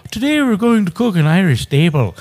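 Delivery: mock Irish cook, high and loud; Irish accent. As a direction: put on a loud, high-pitched Irish lilt. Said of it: "that Irish guy. He's like".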